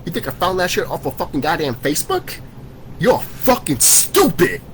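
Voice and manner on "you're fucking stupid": high, drawn-out insult, stretching each word; scornful outburst. Real, not acted. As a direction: lean slowly on every word, pitch leaping up. Ranting at doubters of his sources.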